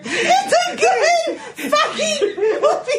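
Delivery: high, drawn-out laughing squeal; helpless laughter. Real, not acted. Defending the movie to her co-host, helplessly laughing. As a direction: squeal it out slowly through real laughter.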